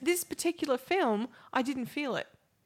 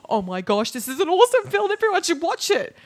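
mock gushing, high and loud; hammed-up rave about a film; breathless mock fan gushing; gushing excitement; 5 semitones higher, 5 dB louder than usual. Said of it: "But I don't go out and go".

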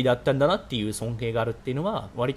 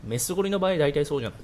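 usual speaking voice

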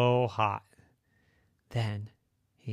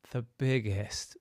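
usual speaking voice

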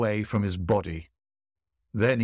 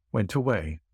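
usual speaking voice

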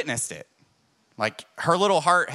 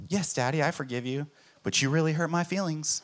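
little daughter's voice; 4 semitones higher, 3 dB quieter than usual. Retold or imitated. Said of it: "daughter voiced in retold scene".

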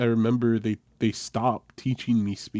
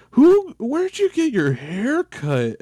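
mocking teacher; 6 dB louder, slower than usual. Teacher mocking his haircut.

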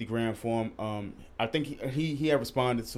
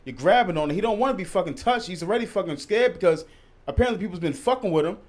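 pitched-up outraged rant at the customer; indignant rant; 6 semitones higher than usual. Real, not acted. Friend ranting about a rude filmer, outraged and high. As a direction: call him out, fired up and high.

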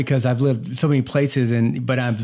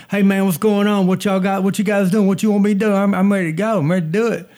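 put-on Southern accent; 8 semitones higher, 4 dB louder than usual. Eager Southern newcomer greeting coworkers on the first day, Southern accent. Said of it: "I went from talking like this".

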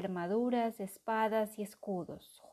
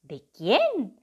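put-on boy voice; 3 semitones higher, 10 dB louder than usual. A narrator's reading. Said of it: "preguntó Ratón".

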